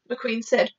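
plain reading voice

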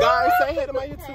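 bubbly YouTube intro; excited. Real, not acted.

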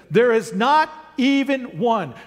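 NOT punched high, EVEN ONE spelled out firmly; emphatic reading. Acted. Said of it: "Do you know how emphatic that is?".

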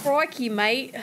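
hammed-up Australian catchphrase; Aussie accent. Streamer testing the requested accent, mock Aussie. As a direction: put on a broad, clichéd Aussie accent. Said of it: "You want me to do an Aussie accent".

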